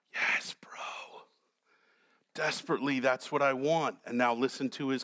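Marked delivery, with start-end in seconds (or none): 0.1-1.2 breathy whispered aside; hushed, conspiratorial whisper. Acted. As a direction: whisper it like a secret wish. Whispered aside voicing David.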